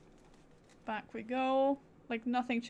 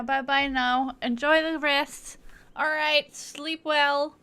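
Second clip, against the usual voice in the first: loud, higher cheery farewell; loud goodbye; 4 semitones higher, 9 dB louder than usual. Real, not acted. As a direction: call out a bright goodbye. Streamer signing off loudly to viewers.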